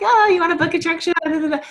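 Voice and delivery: overeager pitch. Retold or imitated. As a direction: rush it out like a too-keen seller. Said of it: "and I'm like".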